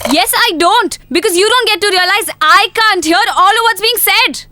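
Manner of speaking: high, exasperated retort; hurt outburst. Acted. Deaf girlfriend confronting boyfriend, hurt and angry. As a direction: hammer home what he refuses to understand.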